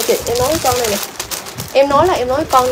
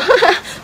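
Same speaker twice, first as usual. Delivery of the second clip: high-pitched laugh; 7 semitones higher than usual. Real, not acted. Seller reacts to pretty dress not fitting, laughing. Amused at comment about sizing.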